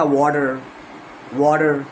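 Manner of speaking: वाटर with a rolled-in r; American water. Shown as a demonstration. Teacher showing the American r to avoid. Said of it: "आपको नहीं जाना है इसमें".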